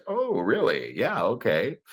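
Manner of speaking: soft, swooping mock interest; quietly dripping sarcasm; sarcastic. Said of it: "So I use sarcasm".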